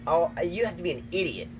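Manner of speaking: ordinary talk, nothing marked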